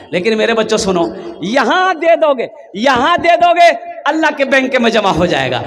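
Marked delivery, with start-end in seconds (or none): 1.4-5.6 high, ringing repeated call; rousing appeal. Real, not acted. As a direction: urge the crowd to give. (जोश से ऊँची आवाज़ में appeal)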